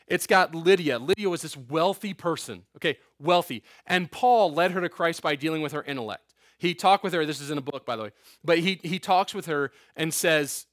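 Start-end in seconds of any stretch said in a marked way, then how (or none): none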